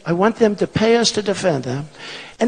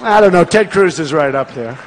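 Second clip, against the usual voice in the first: ordinary talk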